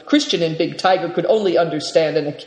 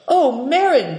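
higher-pitched coaxing plea; begging; 8 semitones higher than usual. A narrator's reading. Naidong coaxes the Marin for a song, begging. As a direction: plead lightly, voice lifted. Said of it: "begged Naidong".

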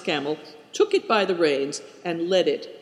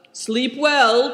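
higher, louder parting call; called farewell; 8 semitones higher, 5 dB louder than usual. A narrator's reading. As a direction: call out over your shoulder. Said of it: "cried the stranger".

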